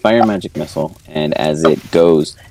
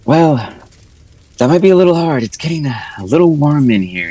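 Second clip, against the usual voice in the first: high, strained and uneasy; worried heroine voice; NPC voice; 10 semitones higher, 4 dB louder than usual. Acted.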